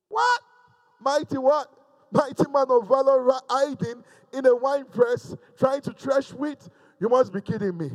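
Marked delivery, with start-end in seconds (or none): 0.1-4.5 high, squeaky, incredulous what; can't believe being called mighty; shocked disbelief. Retold or imitated. Gideon balks at the angel's greeting, shocked and incredulous.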